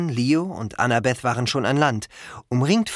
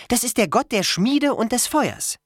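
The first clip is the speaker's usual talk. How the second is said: put-on high, matter-of-fact girl's voice; explaining Annabeth; 11 semitones higher than usual. A narrator's reading. Girl explains the god's names, informative and high. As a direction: explain patiently in a raised pitch.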